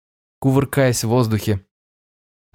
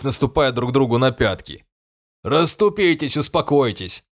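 lightly voiced, commanding king; a hint of a fussy king; fairy king voice; 4 semitones higher than usual. A narrator's reading. The king addresses the crowd. (голос короля)

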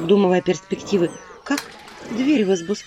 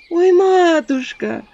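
high, slow, drawn-out wail; a theatrical lament; 11 semitones higher, 4 dB louder, much slower than usual. Acted. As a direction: howl for mercy like a village woman. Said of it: "завыла баба".

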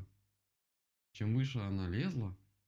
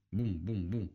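imitated steady knocking, "boom-boom-boom"; woodpecker knocking; 4 dB louder, slower than usual. A narrator's reading. Woodpecker starts on the tree, rhythmic booming knocks. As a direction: knock it out like a beak on wood. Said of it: "застучал дятел".

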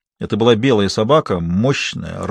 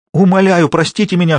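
high, loud begging cry; hoarse cry; 7 semitones higher, 5 dB louder than usual. A narrator's reading. Captive woman cries out to her captor for mercy, loud. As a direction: cry out for mercy, higher and louder. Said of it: "хрипло крикнула Алёна".

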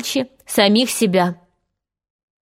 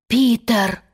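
startled exclamation, kept restrained; mild cry of recognition; exclaiming. A narrator's reading. Boys hearing Peter's crowing, startled exclamation. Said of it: "воскликнули они".

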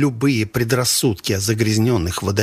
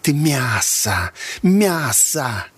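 slow, drawn-out taunt, rising in pitch; mildly menacing, higher on the repeat; hissed threat; 4 semitones higher, much slower than usual. A narrator's reading. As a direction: stretch each word into a menacing verdict. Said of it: "зашипела девка".

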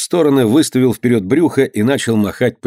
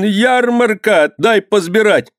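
high, drawn-out begging cry; pleading wail; 10 semitones higher, slower than usual. A narrator's reading. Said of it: "вопил он". (протяжная высокая мольба)

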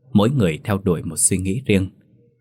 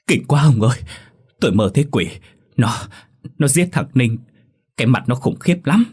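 higher, louder frightened stammer; stammering fright; 4 semitones higher, 3 dB louder than usual. A narrator's reading. Wife tells husband her nightmare, frightened and stammering. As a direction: blurt it out still shaking. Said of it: "run lẩy bẩy, lắp bắp".

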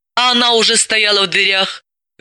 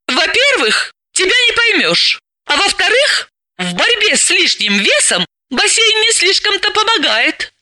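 put-on higher girlish voice, arguing back; Dalila, young and stubborn; 14 semitones higher than usual. Dalila answers her bossy friend, higher and argumentative. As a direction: push back with a lively counter-argument.

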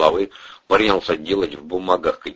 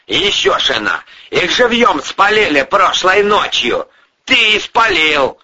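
raised pitch, building to a loud charge; angry accusation; 8 semitones higher, 4 dB louder than usual. Acted. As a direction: pile up the charges, then pounce. Boss hurls formal accusation.

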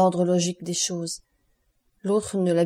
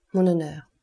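plain reading voice

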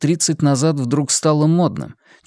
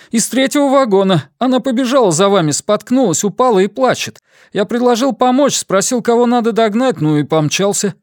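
out of breath; 10 semitones higher, 3 dB louder than usual. A narrator's reading. Man out of breath after running.

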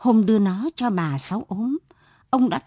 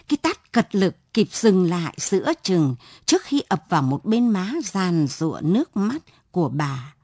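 usual speaking voice

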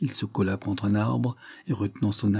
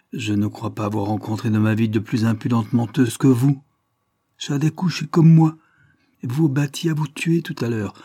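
angry accusation; 2 semitones higher, 6 dB louder than usual. Acted. Lidney accuses her of lying.

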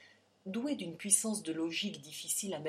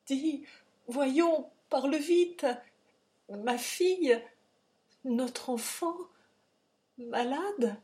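pressant, haut et fort, panique montante; angoisse pressante; 6 semitones higher, 6 dB louder, slower than usual. A narrator's reading. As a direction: supplie vite, la peur au ventre. Père angoissé questionne sur sa fille. (père affolé, voix haute et pressante)

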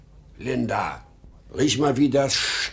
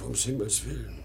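ordinary talk, nothing marked

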